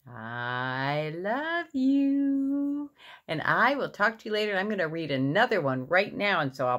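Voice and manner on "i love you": long drawn-out, rising singsong; affectionate singsong. Real, not acted. Parent saying good night to their boy, affectionate singsong. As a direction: stretch every word fondly.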